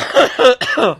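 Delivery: loud disgusted cough; gagging cough. Real, not acted. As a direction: cough from the foul drink. After drinking foul juice.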